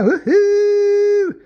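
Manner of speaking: a loud, high "Woohoo!" as a party cheer; joyful whoop. Acted. Joking about rolling a joint.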